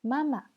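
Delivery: "Mama" high, then falling to a light syllable; tone drill. Shown as a demonstration. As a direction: keep the first "Ma" up, the second short. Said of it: "bien prononcer les quatre tons".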